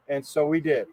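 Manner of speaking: normal talking voice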